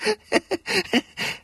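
high cackling laughter; wicked laugh. A narrator's reading. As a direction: laugh in scornful glee. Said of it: "哈哈哈大笑起来".

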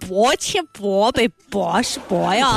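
slow, drawn-out mock Zibo accent. Co-host copying the Zibo counting, slow imitated Zibo accent. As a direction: echo the Zibo counting, stretching each word. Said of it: "你们那个淄博话讲一二三四五六七".

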